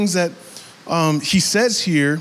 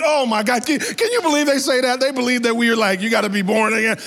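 mocking scoffers; 6 semitones higher, faster than usual. Mocking critics of believers.

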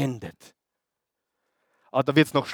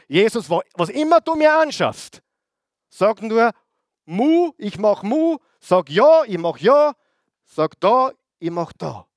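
eager devoted believer; 5 semitones higher than usual. Acted. Rich believer promising Jesus anything, eager and high. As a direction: chirp each promise up high like an eager servant.

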